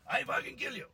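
"I fucking kill you" in a loud, high Italian-accented mock threat. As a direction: bellow it like a hot-headed Italian mechanic. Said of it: "Italian guy with quite a thick accent".

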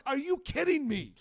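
exasperated "you're joking" outburst; incredulous. Retold or imitated. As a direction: raise pitch and volume, can't believe it. Acted disbelief at friend's lost passport.

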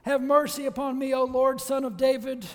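high, loud plea for mercy; crying out. Acted. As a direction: cry out to be heard by the Lord. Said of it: "cries out and says".

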